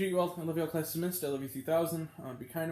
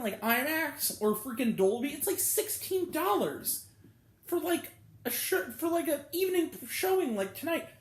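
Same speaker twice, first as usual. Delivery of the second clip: angry rant; 8 semitones higher than usual. Real, not acted. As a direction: let the frustration boil over. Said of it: "side rant 'cause I'm mad".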